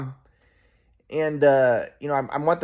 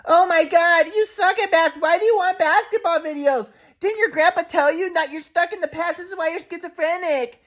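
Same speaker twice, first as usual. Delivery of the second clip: much higher put-on mom voice; high falsetto mom nagging her son; mocking mom impression. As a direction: mimic mom high and scornful. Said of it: "this is actually how she sounded, but worse".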